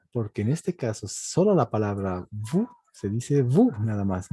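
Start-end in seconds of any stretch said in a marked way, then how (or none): 2.3-3.8 "vous" said as "vu", no S at the end; silent final S. Shown as a demonstration. Teacher shows the word alone, "vous" with silent S. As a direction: leave the S off "vous". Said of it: "no se pronuncia la S".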